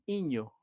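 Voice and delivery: iño, with the first consonant of niño swallowed; dropped sound demo. Shown as a demonstration. Teacher shows a dropped phoneme to the class, saying iño for niño. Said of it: "en lugar de decir niño".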